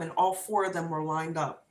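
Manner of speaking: usual speaking voice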